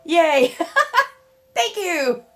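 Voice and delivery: high, loud startled yelp then laugh; excited surprise. Real, not acted. Surprised by a wardrobe slip.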